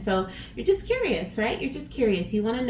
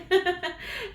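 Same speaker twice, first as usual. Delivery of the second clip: short giggle; 10 semitones higher than usual. Real, not acted. Laughs in the sign-off.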